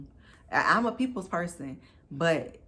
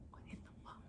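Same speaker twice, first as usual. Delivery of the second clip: stunned whisper; 22 dB quieter, much faster than usual. Retold or imitated. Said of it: "I was like".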